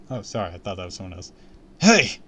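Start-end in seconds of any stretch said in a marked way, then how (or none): 1.8-2.2 high, loud cry; calling out. Acted. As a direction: shout to stop him. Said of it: "I cried".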